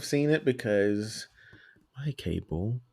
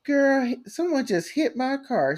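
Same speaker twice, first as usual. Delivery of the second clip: put-on high girly ad voice, much higher pitch; girl in an ad; 14 semitones higher, 3 dB louder than usual. Mocking the little girls in a car wreck ad. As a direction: sing it out like a gossiping girl. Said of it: "she was like".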